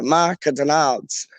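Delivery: Macadonalds as four stately syllables; posh pronunciation. Acted. Diner names the place, posh Macadonalds. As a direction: draw out Ma-ca-donalds grandly. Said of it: "That sounds like posh".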